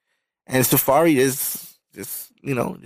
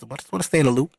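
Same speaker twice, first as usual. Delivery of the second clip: ordinary talk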